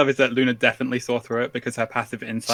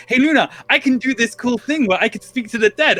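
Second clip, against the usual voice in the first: eager; 8 semitones higher, 3 dB louder than usual. Retold or imitated. Angus eagerly boasting to Luna.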